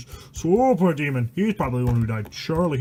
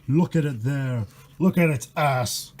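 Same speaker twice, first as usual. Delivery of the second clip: ordinary delivery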